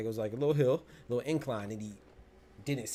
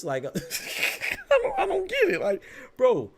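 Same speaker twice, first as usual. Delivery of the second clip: high, loud, incredulous laughter; laughing disbelief; 8 dB louder, much slower than usual. Real, not acted. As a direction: laugh helplessly at the absurd idea.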